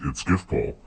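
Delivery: ordinary delivery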